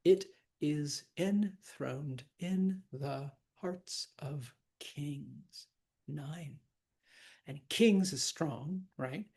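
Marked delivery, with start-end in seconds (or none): 0.0-5.7 slow, evenly separated syllables. Shown as a demonstration. Teacher counting a line for students, slowly syllable by syllable. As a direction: count out each syllable, "enthroned" in two. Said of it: "Count the syllables".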